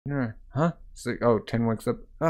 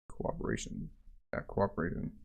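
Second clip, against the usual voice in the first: hushed, breathy echo of the word; whisper; 4 semitones lower, 14 dB quieter than usual. A narrator's reading. Narrator echoing the theme, whispered. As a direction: whisper it like a secret motto.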